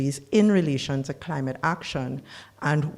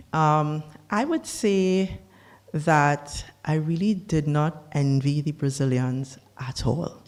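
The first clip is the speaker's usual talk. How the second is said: normal talking voice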